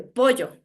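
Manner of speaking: "pollo" as an Ecuadorian highlander says it, double L; careful Sierra double L in "pollo"; highland pronunciation. Shown as a demonstration. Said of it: "escuchen mi fonema".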